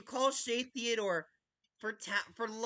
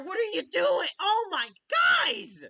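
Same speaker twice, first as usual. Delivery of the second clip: usual speaking voice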